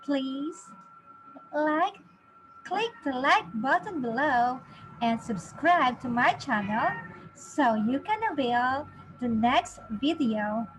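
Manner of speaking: usual speaking voice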